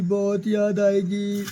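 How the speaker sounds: drawn out, slow and sorrowful; a slow, mournful "बहुत याद आएगी"; slow tearful farewell. Acted. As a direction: stretch it out like you're about to cry. Sister's farewell to bride.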